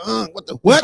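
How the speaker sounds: high, loud startled what; shocked reaction. Retold or imitated. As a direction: jolt up in disbelief.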